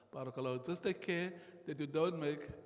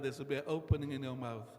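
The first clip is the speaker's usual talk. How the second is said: usual speaking voice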